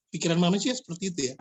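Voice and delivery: ordinary talk, nothing marked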